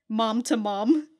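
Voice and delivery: ordinary talk, nothing marked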